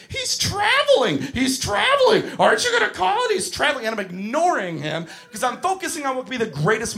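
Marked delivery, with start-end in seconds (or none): 0.1-3.9 high, loud mock scream of a tattling kid; screaming kid imitation. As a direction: shriek the accusation like an outraged child, dragging the words out. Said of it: "starts screaming".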